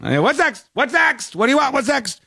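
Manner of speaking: high, rapid-fire barked questions; mock barking. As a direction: pepper them like a rude drive-through bot. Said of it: "just shouting at you".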